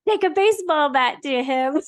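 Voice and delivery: a hint of real frustration under the joke; mock rage. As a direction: playfully fake fury at her husband. Joking about anger at husband.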